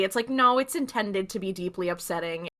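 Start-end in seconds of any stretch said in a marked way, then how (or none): none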